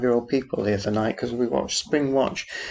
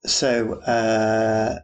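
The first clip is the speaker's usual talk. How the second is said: ordinary delivery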